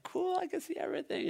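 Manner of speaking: put-on very high, excited kid voice; Easton. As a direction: imitate a thrilled boy way up high. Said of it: "He's like".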